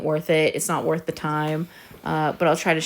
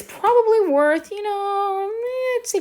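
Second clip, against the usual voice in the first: excited; 3 dB louder, slower than usual. Real, not acted. Excited guess at the mask's value.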